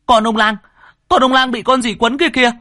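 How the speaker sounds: high, loud terrified scream. A narrator's reading. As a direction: howl it out, pointing in horror. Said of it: "Cậu chỉ tay mà gào lên".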